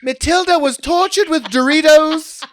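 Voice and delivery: milk the grim tale for laughs; hammed-up ghost-tour storyteller. Comic mocking Civil War house ghost stories, hammed-up storyteller. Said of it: "their stories are also so wild".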